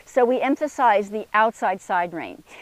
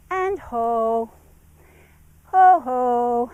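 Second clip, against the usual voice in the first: ho dropping downward in pitch, the last ho louder; falling ho command; 2 semitones higher, 3 dB louder, much slower than usual. Shown as a demonstration. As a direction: let each ho fall off to settle him. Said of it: "downward transitions have a downward inflection".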